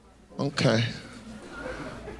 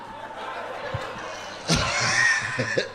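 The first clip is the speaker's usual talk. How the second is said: big laugh; 3 semitones lower, 6 dB quieter than usual. Real, not acted. Laughs after own bit.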